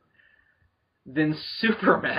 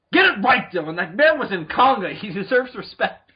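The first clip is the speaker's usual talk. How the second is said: loud, higher-pitched fan outrage; mock indignation; 4 semitones higher, 4 dB louder than usual. Podcaster sticking up for a B-movie actor, mock-indignant. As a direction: indignantly defend the man, loudly.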